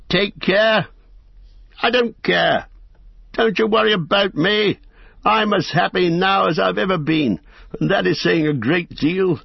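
laughing off the warning, elated; carefree hobbit. Acted. Bilbo brushing off warning.

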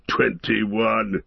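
lightly voiced dwarf tally; Gimli. A narrator's reading. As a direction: state the count in a dwarf's voice, not cried out. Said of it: "said Gimli".

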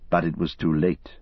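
plain reading voice

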